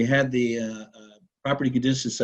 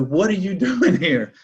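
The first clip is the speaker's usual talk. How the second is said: retold yell; 7 semitones higher than usual. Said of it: "And I yell at him".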